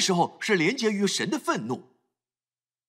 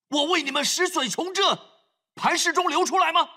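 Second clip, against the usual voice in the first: angry rebuke; 8 semitones higher than usual. Retold or imitated. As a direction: throw the question at them in anger. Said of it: "他却是这么做". (摩西质问百姓，怒气冲冲)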